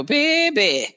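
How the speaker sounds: put-on high, drawn-out New Orleans "Baby"; "Baby" sung up high in a New Orleans accent. Shown as a demonstration. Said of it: "my accent don't come out until".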